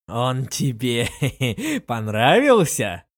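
slow, mocking with a laugh; gleeful sneer. A narrator's reading. Lin Yueru mockingly repeats her friend's confession, chuckling. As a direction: savour it with a wicked chuckle. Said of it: "заулыбалась ещё более злобно".

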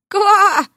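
high, loud mock croak; frog croak.

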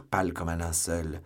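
usual speaking voice

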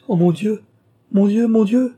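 high, loud frightened cry; sudden terror. A narrator's reading. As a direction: cry out in sudden dread, pitch leaping up. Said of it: "pâlit, trembla".

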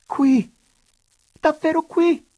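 high, loud joyful outburst; overjoyed high exclamations; furious jubilation. A narrator's reading. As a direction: burst out with incredulous delight. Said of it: "proruppe allora con furia di giubilo".

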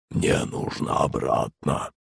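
soft, slow voiceless creature; gurgling monster voice. A narrator's reading. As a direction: bubble the words out quietly. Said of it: "пробулькал Водяной".

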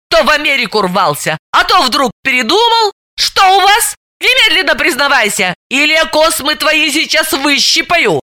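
high, shrill threatening rant; истерично; hysterical accusation. Acted. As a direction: rage and threaten to pull her hair.

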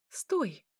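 mild, sudden cry to halt. A narrator's reading. Princess abruptly halting the vampire, crying out. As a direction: call out a contained order. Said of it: "выкрикнула Эля".

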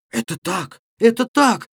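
rising to a high, loud cry; shouted agreement. A narrator's reading. All the lads backing Левко at once, shouting. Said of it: "закричали в один голос все хлопцы".

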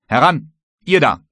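loud, high bosun's call; commanding. A narrator's reading. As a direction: order the men to step up. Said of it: "kommandierte der Bootsmann". (lautes Kommando)

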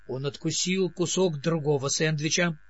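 usual speaking voice

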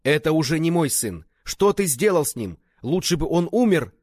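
high, loud, bitter outburst; enraged shouting. A narrator's reading. Father kicking the man he blames, enraged shouting. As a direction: shout as you disown the child. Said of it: "закричал Фа Шэньхао".

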